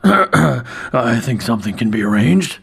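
slow, choked-off words from a stunned barman; strangled voice. A narrator's reading. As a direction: squeeze it out as if swallowing a cough. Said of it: "he said in a strangled voice".

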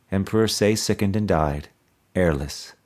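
ordinary delivery

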